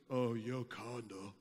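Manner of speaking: head down, barely owning it; ashamed mumble. Retold or imitated. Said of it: "he dropped his head".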